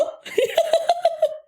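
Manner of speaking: high, sudden real laugh; cracking up at the memory; burst of laughter. Real, not acted. Telling viewers about a coworker, real high laugh. Teller cracks up at own story.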